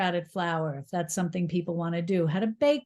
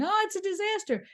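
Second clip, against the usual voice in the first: mock wail; 12 semitones higher, faster than usual. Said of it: "running out of the kitchen crying".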